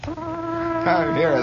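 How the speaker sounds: high, drawn-out captain's call; captain's shout. A narrator's reading. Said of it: "the captain shouted".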